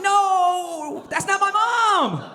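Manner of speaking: helpless falsetto protest; defenseless squeal. Retold or imitated. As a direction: squeak it out like a cornered kid. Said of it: "I'm just up there defenseless".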